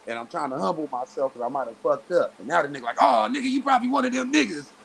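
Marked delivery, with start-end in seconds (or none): 3.0-4.7 high-pitched imitation of an accuser; raised voice, retold taunt; mocking accusation. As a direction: mimic the opponent jeering. Mimics opponent's accusation.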